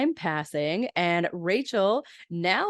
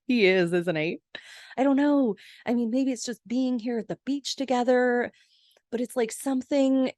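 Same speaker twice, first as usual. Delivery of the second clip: high, giddy retold excitement; Rachel all excited; 5 semitones higher than usual. Friend confiding that he is flirting, imitated high excitement. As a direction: gush it in a higher voice, half unsure. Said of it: "Rachel gets all excited".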